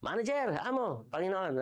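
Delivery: high, fawning calls one after another; butter up the boss with each title; mock servile fawning over the boss; fawning servant. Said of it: "Si abang-yabang ni Burnok".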